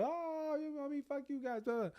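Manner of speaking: very high, held mock whine; parting grumble. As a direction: trail insults in a high voice as if walking away. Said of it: "You know how you walk away and you still".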